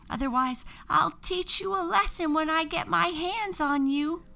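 high angry witch rant; shouting with anger. A narrator's reading. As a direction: threaten the angel furiously. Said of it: "shouted with anger".

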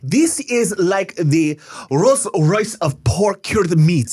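high, hammed-up Italian salesman accent. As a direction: sell it like a showy Italian deli man.

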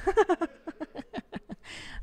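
a high cackling laugh breaks out; high burst of laughter. Real, not acted. Host laughing at the kid's line, high cackling laugh. Reading funny kid story.

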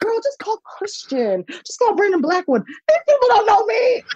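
high, whiny panicked plea; begging a friend in mock despair; frantic. To her friend, frantic about the red carpet, high whiny plea. Excited retelling of frantic moment.

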